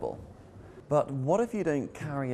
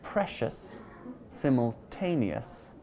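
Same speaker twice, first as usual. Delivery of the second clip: "Precious" and "simultaneous", stressed on PRE and TA; stress before the suffix; slower than usual. Shown as a demonstration. Teacher demonstrating the rule, "Precious" and "simultaneous" stressed before the ending. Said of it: "stress the syllable before the ending".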